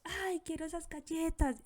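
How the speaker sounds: put-on high whiny kid voice; demanding child begging for cookies, high whine. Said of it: "el niño me está diciendo".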